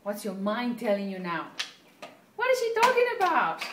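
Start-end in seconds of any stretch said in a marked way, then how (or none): 2.4-3.6 high, loud, baffled inner voice; puzzled listener's voice. Pretend. Voicing a confused viewer's thought, high and loud. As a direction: play the confused listener who doesn't follow the teacher.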